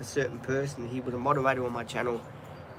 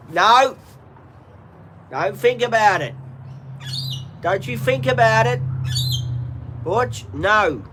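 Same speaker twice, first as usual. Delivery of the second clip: loud, higher, sharp warning at the dog; stern no; 8 semitones higher, 6 dB louder, slower than usual. Real, not acted. Owner stopping his dog Butch from going for the shoe, loud stern warning. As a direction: bark a firm no at a naughty dog.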